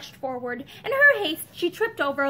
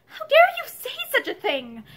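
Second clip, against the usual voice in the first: high, louder indignant outburst; flashing out; 11 semitones higher, 5 dB louder than usual. A narrator's reading. Wife defending her son to her husband, flashing out angrily. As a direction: flare up in outrage. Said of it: "Pansy flashed out".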